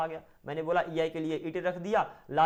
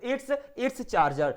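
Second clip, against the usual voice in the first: "it's, it's charger" said very slowly and higher; slow model answer; 4 semitones higher, much slower than usual. Shown as a demonstration. (धीरे और ऊँचे "it's, it's charger")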